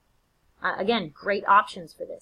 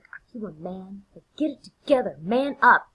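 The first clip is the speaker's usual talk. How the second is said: scolding Lady Macbeth; 4 dB louder than usual. Acted. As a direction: goad your husband, quickening and getting louder. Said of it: "pulls her husband together and is like".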